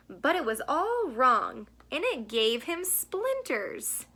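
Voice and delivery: gentle storybook read-aloud; reading to children. A narrator's reading. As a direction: read it like a bedtime picture book. Reads picture book to children.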